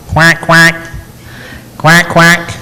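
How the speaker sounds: mimicked duck quacking, quack quack. Monk imitates the duck the couple hears, mock quacking.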